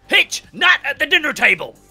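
loud, high-pitched telling-off in Cook's voice; Cook scolding. Acted. Cook scolds Hitchhiker for fighting at dinner, hollered high. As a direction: holler it as a scandalised reprimand. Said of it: "Cook's like".

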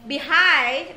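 Behind with "hind" loud and long; light "Be", punch the "hind" in Behind; stress on second syllable. Shown as a demonstration. Teacher showing students the stress, Behind hit on the second syllable. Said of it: "âm thứ hai cho cô".

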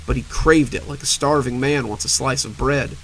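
ordinary delivery, nothing marked

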